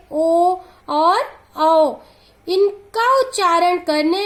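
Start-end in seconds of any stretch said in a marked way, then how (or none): 0.1-1.9 slow recitation. Shown as a demonstration. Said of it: "इसकी संख्या सात होती है।".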